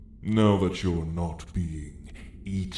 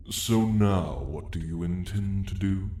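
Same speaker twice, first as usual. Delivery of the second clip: ordinary talk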